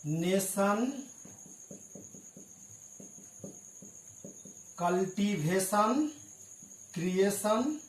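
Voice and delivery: नेशन, कल्टीवेशन, क्रिएशन with the ending said sun, not tun; teacher demo of the shun sound; tion as shun. Shown as a demonstration. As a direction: slowly spell out the shun ending. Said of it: "सभी टन को आप सन पढ़ेंगे".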